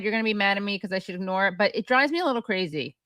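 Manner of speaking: ordinary delivery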